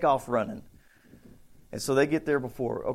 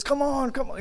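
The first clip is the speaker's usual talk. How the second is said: hurrying mother; 11 semitones higher than usual. Retold or imitated. Mother hurrying her kids.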